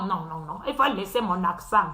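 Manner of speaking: usual speaking voice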